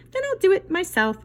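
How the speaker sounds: high, loud determined hen; plucky little hen; resigned hen. Acted. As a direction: say it like a hen who gives up asking. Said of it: "said the little red hen".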